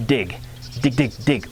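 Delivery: "dig" said short and rhythmic with the tongue; quick repeated "dig, dig"; dig syllables. Shown as a demonstration. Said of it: "now we're gonna go".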